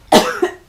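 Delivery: sharp coughing fit; choking cough on water. Real, not acted. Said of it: "water went down the wrong way".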